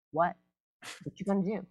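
soft, understated challenge; lightly voiced badass cat. Owner voices small cat facing her down, quiet cocky challenge. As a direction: quietly dare them, playing a tough cat. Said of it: "this badass cat".

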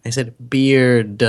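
"beard" drawn out with a heavy D; stressed final D. Shown as a demonstration. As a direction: hit the D at the end of "beard". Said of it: "You said beer".

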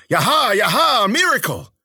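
high, loud whooping cries of discovery; excited yelling. A narrator's reading. Jaguar yelling to the men at the monolith, excited yell.

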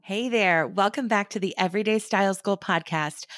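ordinary talk

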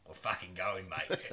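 mock Australian girlfriend, low; lightly voiced low Australian woman; deep Australian accent. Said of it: "She's got a very deep voice".

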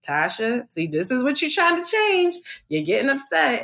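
lightly voiced divine voice checking her temper; inner voice of God. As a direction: let the Lord's voice correct her lightly. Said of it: "I could hear him in my head saying".

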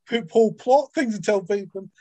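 normal talking voice

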